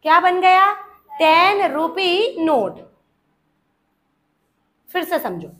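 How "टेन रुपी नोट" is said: drawn-out, deliberate teaching delivery; slow, evenly stretched words; slow model answer. Shown as a demonstration. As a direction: give each word its own space for the class.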